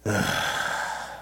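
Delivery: low breathy grumble of dread; groaning at news that the grandsons are coming; grumbling ugh. Retold or imitated. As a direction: let out a weary groan. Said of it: "And there's that grumble".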